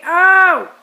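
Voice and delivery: sharp loud yelp of pain; pained cry. Real, not acted. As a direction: cry out as the pin bites. Clothespin pinches face.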